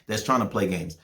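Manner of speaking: ordinary talk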